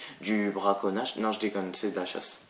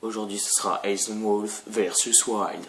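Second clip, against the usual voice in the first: slow announcer; slower than usual. Pretend. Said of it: "j'ai un peu trop bu".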